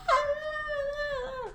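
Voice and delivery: very high fan scream; mock crowd scream. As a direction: imitate fans shrieking. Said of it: "people just screaming".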